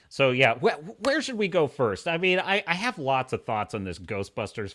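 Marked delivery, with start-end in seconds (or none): none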